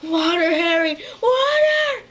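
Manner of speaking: high, loud cracking plea; crying shout, rising on the second water; desperate cry. Retold or imitated. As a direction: cry out hoarsely, begging for water. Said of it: "so then he's like".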